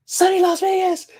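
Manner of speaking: high, excited squeal of his name. Retold or imitated. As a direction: shriek the name like a starstruck fan. Lady calls out his name.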